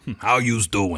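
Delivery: a hint of a wise-guy accent; lightly voiced New York tough guy; mimicking the restaurant waiter; wise-guy greeting.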